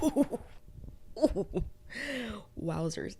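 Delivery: squealed high ooh then wowzers settling down; excited ooh. Real, not acted. Host reacting to Louis calling Armand out, thrilled. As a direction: react like you just saw drama.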